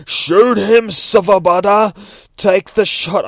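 high, loud, drawn-out command; urgent shouted order. Acted. Nightwing ordering the detective to fire, urgent shout. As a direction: yell it across to a sniper.

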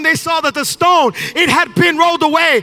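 loud, high, shouted sermon climax; full-force preaching. Real, not acted. Pastor rallying the congregation about the resurrection, full-force preaching. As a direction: preach it with everything you have, building up.

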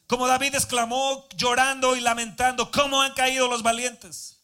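fervent spoken prayer; earnest prayer after the reading. Real, not acted. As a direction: pray earnestly to the Father. Prayer continues.

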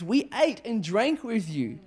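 high, slow, emphatic appeal; indignant protest. Acted. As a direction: plead your case, affronted. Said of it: "how the people here would have felt".

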